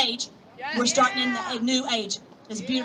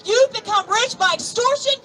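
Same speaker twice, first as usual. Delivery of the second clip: fiery preaching; 6 semitones higher, slower than usual. Acted. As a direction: thunder the prophecy at them, slowly. Preaching scripture to the crowd.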